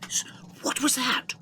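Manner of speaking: frightened whispered question; startled whisper. Acted. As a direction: hush it, alarmed by the noise. Hiding, hears a noise.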